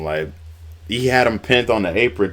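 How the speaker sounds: usual speaking voice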